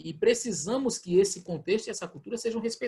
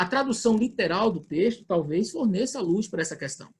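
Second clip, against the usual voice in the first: ordinary talk, nothing marked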